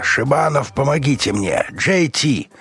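high-pitched, pleading call; cry for help. A narrator's reading. Wounded Роллинсон begging his companions, high cry for help. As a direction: call out for rescue.

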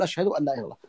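listener's reply. Shown as a demonstration. Teacher showing the listener's quiet reply, soft and falling. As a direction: murmur the answer back quietly. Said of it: "نقول".

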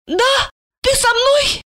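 high-pitched hopeful woman; light up with childlike hope, very high; delighted tipsy question; naive joy. A narrator's reading. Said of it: "Она наивно обрадовалась".

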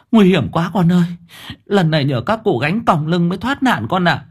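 a mother clutching her chest in relief; lightly voiced mother fretting over her son. A narrator's reading.

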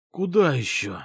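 groggy half-asleep question; just woken up. Acted. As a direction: sound barely awake. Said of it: "спросонья спросил я".

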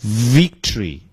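"victory" slowly, with a strong buzzing v; slow, careful v in "victory"; V word demo. Shown as a demonstration. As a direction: bite the lip to start "victory". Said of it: "胜利念".

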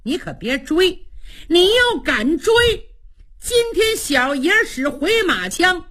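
high-pitched challenge to a pursuer; acted taunting threat. Luo warns Shan not to chase, high taunting threat. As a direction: call it out like a cocky warrior. Warrior calls threat while riding off.

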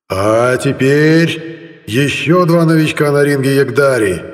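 high, slow loudspeaker announcement; booming loudspeaker. A narrator's reading. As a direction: strain it out over the speakers. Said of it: "надрывались динамики".

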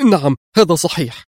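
high-pitched, nervous admission; hasty answer. A narrator's reading. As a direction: confirm it as if under threat. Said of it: "جعلته يجيب في سرعة". (إجابة متوترة)

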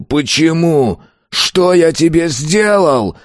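high, slow desperate yell; anguished shout. A narrator's reading. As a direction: scream it, lungs tearing. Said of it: "заорал он".

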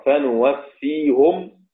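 slow فنوفيهم with nun, fenuwafihum; Rawh reading. Shown as a demonstration. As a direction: recite فنوفيهم with nun and -hum. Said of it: "روح بيقرأ".

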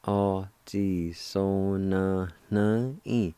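"O ji so na na in." stretched slowly; slow, careful teaching pronunciation; slow demo. Shown as a demonstration. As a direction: draw out every syllable of "O ji so na na in.". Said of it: "It's pronounced like this".

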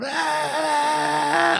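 long high held mock scream; imitated sun scream. Imitating the screaming sun for a co-host, sustained mock scream. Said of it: "it's just a screaming sun".

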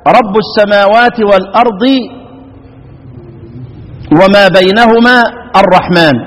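slow, measured tajweed recitation; Quran recitation. Acted. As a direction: recite the verse with careful tajweed. (تلاوة مجودة بطيئة)

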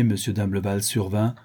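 ordinary delivery, nothing marked

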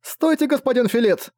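put-on high-pitched, dismayed girl's call; flustered exclamation. A narrator's reading. Girl calls after Filit as he leaves, flustered cry. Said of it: "растерянно воскликнула девушка".

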